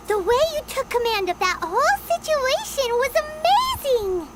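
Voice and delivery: high, bubbly rush of admiration; admiring friend cheering Yoimiya; excited praise. Acted. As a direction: gush about how amazing she was.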